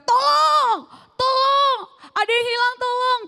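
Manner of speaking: high, loud shout for help. Retold or imitated. Heartbroken guy screams for help in the street, high loud shout. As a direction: scream it out like you lost something. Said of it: "gua lagi jalan tiba-tiba gua teriak".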